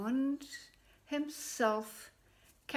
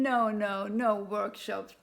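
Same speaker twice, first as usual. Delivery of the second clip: laughing; 5 dB louder than usual. A narrator's reading. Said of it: "laughed the man".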